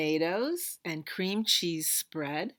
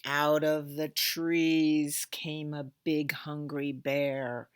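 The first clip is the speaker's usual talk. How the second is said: plain reading voice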